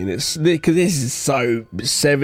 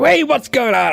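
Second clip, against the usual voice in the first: imitating a blustering detective, louder and higher; classic detective; 9 semitones higher, 4 dB louder than usual. Mimicking the film's detective, louder and higher. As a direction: bark it like a seventies cop. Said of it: "He's like".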